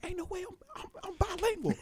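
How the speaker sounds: high-pitched comic incredulity; retold giddy disbelief; amazed disbelief. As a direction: squeal it like astonishing news. Retold amazed reaction to realization.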